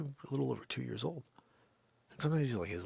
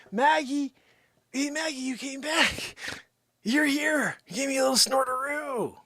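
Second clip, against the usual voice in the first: squeaky, much higher sweet talk to the dog; high baby voice for the dog; 13 semitones higher, 4 dB louder than usual. Acted. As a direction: coo at the dog like she's a baby.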